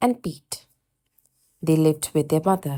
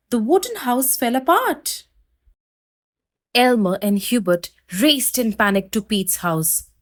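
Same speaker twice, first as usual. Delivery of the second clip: ordinary delivery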